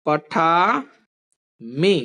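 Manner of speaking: drawn-out, very slow पठामि; slow dictation. Shown as a demonstration. Teacher dictates पठामि to class, very slow. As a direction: stretch out पठामि syllable by syllable for students to copy. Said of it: "और फिर लिखो".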